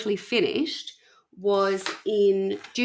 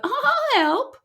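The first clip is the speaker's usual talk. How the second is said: mock eager helper. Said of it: "You thought".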